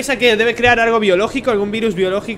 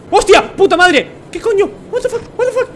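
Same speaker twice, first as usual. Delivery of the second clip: high, loud panicked yelling; startled shrieks of real fright; terrified shout; 10 semitones higher, 5 dB louder than usual. Real, not acted. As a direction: scream it out as the mannequin lunges. Attacked by a mannequin.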